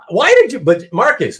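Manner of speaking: usual speaking voice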